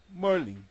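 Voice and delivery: MERLIN as MUR-lin; English-style Merlin, rounded first vowel; American pronunciation. Shown as a demonstration. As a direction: say Merlin with English 'ur' in front. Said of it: "Se pronuncia Merlin".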